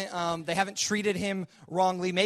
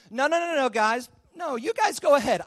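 higher, louder brushing-off; brisk dismissal; 6 semitones higher, 4 dB louder than usual. Retold or imitated. Joseph sending the brothers home, brisk high dismissal. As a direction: wave them away, light and quick.

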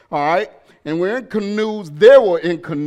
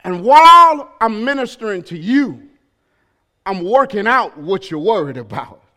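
impassioned sermon climbing louder and higher; preaching build; 7 semitones higher, 6 dB louder than usual. Real, not acted. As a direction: build each "while" toward a shouted peak. Preaches, building again to full force.

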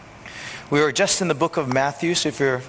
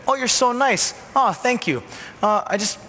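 higher, gushing thanks; gushing admirer; 6 semitones higher than usual. Retold or imitated. Praise after a good deed, higher and gushing. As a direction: swoon over the kindness. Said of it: "Do the good works".

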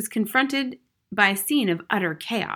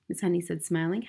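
plain reading voice

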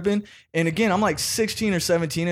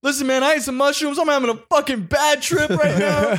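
much higher, pleading; scared teen admitting a bad trip to his dad; panicked confession; 10 semitones higher than usual.

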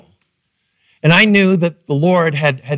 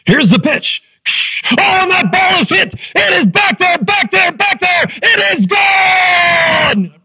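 sports announcer; 10 semitones higher than usual. Retold or imitated. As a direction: call the homer like a radio announcer, rising to a big finish. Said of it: "I pitched my voice like that".

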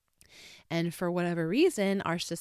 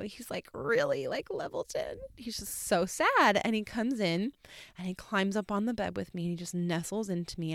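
usual speaking voice

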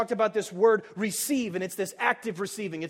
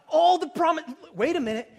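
ordinary talk, nothing marked

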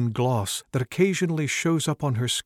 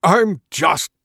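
growl; 6 dB louder, slower than usual. Acted. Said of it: "he growls".

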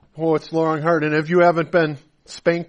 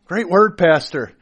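eager praise; 3 semitones higher, 7 dB louder than usual. Retold or imitated. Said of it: "I was hoping that I would get some praise like".

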